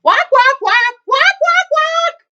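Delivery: very high, loud squawking quacks; piercing, full-volume goose racket; goose shouting. A narrator's reading. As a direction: raise the alarm as a noisy goose. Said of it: "noise of a goose shouting".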